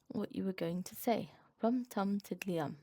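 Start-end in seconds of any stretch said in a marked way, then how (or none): none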